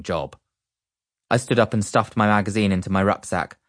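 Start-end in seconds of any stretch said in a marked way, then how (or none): none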